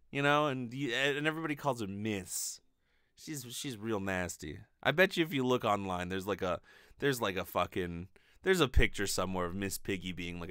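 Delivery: ordinary talk, nothing marked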